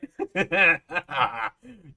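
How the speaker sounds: high, loud burst of laughter; amused laugh. Real, not acted. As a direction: laugh out at the other's fear. Laughs at other's fear.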